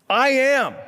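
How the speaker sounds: high, loud proclamation; bold announcement. Retold or imitated. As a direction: boom it out as a divine announcement. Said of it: "this is an announcement".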